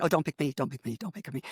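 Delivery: quiet, low pleading under the breath; mortified inner plea. Retold or imitated. Sitting in class hoping not to be picked, mortified. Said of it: "I'm sitting there just mortified going".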